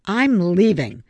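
angry, final announcement; indignant parting shot; heated. A narrator's reading. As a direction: end the argument hotly. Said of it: "Savannah interrupted hotly".

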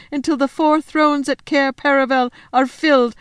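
high, loud, wailing faun lament; tearful wail. Acted. Faun listing the witch's punishments, wailing in distress. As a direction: pile up the horrors, close to tears. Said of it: "beginning to cry again".

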